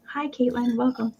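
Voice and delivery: soft whispered greeting; whispered hello. Real, not acted. Seller whispering hello to a new viewer. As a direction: whisper a welcome.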